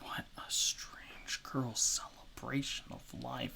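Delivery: whispered muttering under her breath. Acted. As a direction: mutter it to yourself behind a closed door. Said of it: "you hear her muttering".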